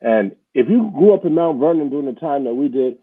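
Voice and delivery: usual speaking voice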